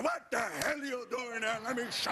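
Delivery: high-pitched outraged German grandfather; German-accented grandfather. Retold or imitated. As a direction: scold in a put-on German accent. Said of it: "My grandfather".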